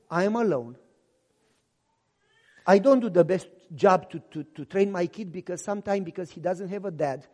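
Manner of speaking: divorced mother confessing to the pastor, soft and low. Retold or imitated. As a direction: admit it quietly, ashamed.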